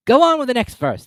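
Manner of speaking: high, loud, firm creature; a high, loud Griffin command; Griffin repeating. A narrator's reading. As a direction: insist again. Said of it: "the Griffin repeated".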